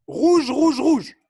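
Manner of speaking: loud, high repeated colour call; called-out command. Real, not acted. Coach sends players to one cone, loud high call. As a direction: shout the colour three times as an order.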